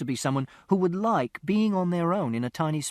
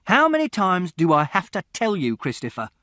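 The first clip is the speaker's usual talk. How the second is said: father's voice louder, then dropping on the name; exasperated father; 4 semitones higher, 7 dB louder than usual. A narrator's reading. Father scolding Christopher, exasperated and loud. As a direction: bark it out, then bite off the name.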